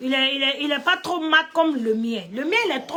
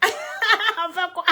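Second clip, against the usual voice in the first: laughing; 9 semitones higher, much slower than usual. Real, not acted. Laughing mid-question at own joke.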